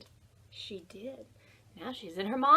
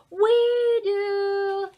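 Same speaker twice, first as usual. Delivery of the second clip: much higher, louder "we do"; eager boys; 10 semitones higher, 11 dB louder than usual. A narrator's reading. Boys to Ruby, a much higher, louder eager reply. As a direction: leap at the offer.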